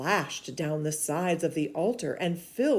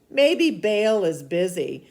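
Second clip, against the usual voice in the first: teasing; 7 semitones higher, 9 dB louder than usual. Acted. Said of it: "Elijah began to tease them".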